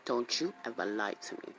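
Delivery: low and dangerous, hold it back; low stern warning. Retold or imitated. Mom scolds son in public, low and menacing.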